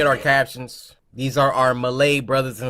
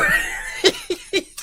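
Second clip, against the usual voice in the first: shrill burst of laughter; high laugh. Real, not acted. Laughing at the creepy ASMR greeting, high burst. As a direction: crack up high.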